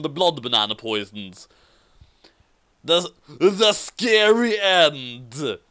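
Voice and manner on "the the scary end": drawn-out spooky close; slow it right down for effect; slow dramatic ending. Acted. Storyteller announcing the scary end slowly.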